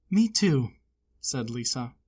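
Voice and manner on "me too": put-on higher, louder child; happy girl. A narrator's reading. Lisa to Corduroy, gladly. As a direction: answer gladly.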